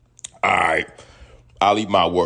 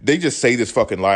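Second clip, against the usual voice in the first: ordinary delivery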